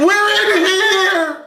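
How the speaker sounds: imitating a scream; mock yell. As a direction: lightly ham up the panicked yell. Mocking the screaming girl.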